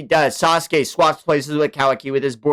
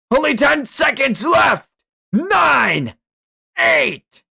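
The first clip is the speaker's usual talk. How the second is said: much higher, louder counting, slow at first; shouted countdown; 14 semitones higher, 5 dB louder, much slower than usual. Acted. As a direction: bark each number like a threat. Villain counts down aloud.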